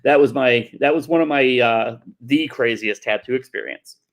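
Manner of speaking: ordinary talk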